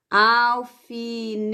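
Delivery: al-fi-ne-te, very slow, syllables pulled apart; slow syllable split. Shown as a demonstration. Teacher showing pupils how to split alfinete, slowly. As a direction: draw out alfinete one syllable at a time. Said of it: "daí vão separar em sílabas".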